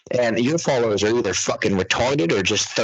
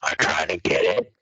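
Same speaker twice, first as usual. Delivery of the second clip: usual speaking voice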